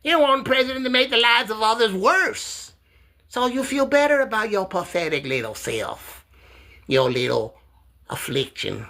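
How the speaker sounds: high, mocking sneer at Mark; high-pitched mocking jab at a heckler; high taunting voice.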